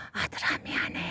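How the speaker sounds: gently whispered apology, almost no voice; soft whisper. Retold or imitated. Mother whispering to her sleeping son, hushed whisper. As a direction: lean in and whisper it into a sleeping ear. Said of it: "살며시 귀에다 대고".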